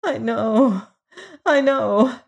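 high, plaintive whimper. A narrator's reading. Foolish lady conceding to Hagar, high whimper. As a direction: whimper it, pitched well up. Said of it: "whimpered the foolish little woman".

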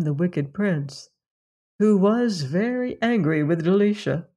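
plain reading voice

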